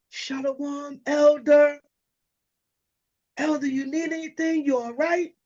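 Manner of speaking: high, sugary churchy greeting; a sister being extra polite to the elder; sweet sister greeting. Retold or imitated.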